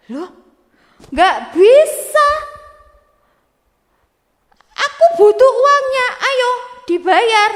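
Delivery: keras dan lambat, menuntut bayaran; marah. Acted. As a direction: bentak dia supaya membayar. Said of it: "marah-marah adik-adik". (membentak dengan keras dan lambat)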